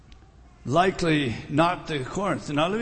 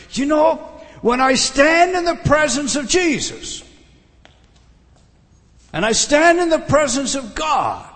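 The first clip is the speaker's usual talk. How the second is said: higher and louder preaching swell; rising fervor; 7 semitones higher, 5 dB louder than usual. Real, not acted. Preacher telling the congregation of standing before God, loud and fervent. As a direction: lift it up with fervor.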